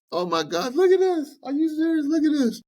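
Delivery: high, loud cackling disbelief; mocking shock. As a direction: hoot at the credit report like it's a joke. Bank staff mocking your credit.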